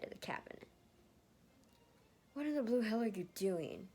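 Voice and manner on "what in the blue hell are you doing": louder, higher angry question; angry demand. Acted. Ned angrily confronting Lucas across the cabin. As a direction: confront him hard.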